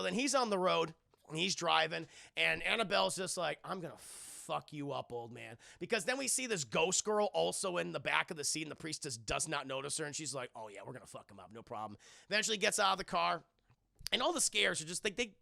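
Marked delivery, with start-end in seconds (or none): 3.6-5.6 slow, much softer menacing threat; quiet creepy threat to the priest; menacing doll whisper. Retold or imitated. 10.5-12.0 very low, very soft sinister agreement; creepy ghost girl, barely audible. Retold or imitated.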